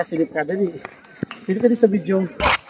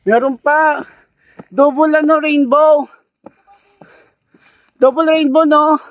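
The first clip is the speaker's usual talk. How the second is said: while running; 8 semitones higher, 4 dB louder than usual. Real, not acted. Excited on trail, double rainbow.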